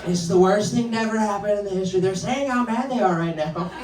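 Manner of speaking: high, indignant politician impression; Trump. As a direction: rant as an upset Brooklyn girl who talks like a politician. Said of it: "They talk exactly like Trump".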